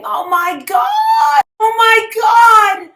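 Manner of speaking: high, slow, crying-out panic; loud anguished oh my God; panicked cry. Retold or imitated. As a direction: wail it out in alarm. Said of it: "I hear Andrew saying".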